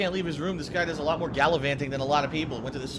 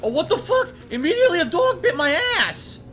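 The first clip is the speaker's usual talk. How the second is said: high, loud disbelieving shouts; shocked yelling; 8 semitones higher, 5 dB louder than usual. Real, not acted. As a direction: scream in outraged disbelief. Dogs keep attacking, shocked yelling.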